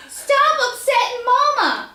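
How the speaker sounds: put-on high, loud, slow child's yell; angry shout. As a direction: snap at him to leave Mama alone. Child yelling at cousin.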